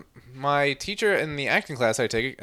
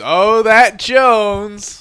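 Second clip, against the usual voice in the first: drawn-out, louder and higher fond sigh; fond guard sigh; 8 semitones higher, 11 dB louder, much slower than usual. Retold or imitated. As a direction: sigh it out slowly like a kindly old guard. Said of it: "And the security guard goes".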